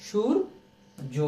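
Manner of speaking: সূর্য very slowly, split as "shur... jo"; syllable-split demonstration. Shown as a demonstration. Teacher showing students the changed j, drawn-out demonstration. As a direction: draw out সূর্য with a pause before a j-sounded jo. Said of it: "হয়ে যাবে কি?".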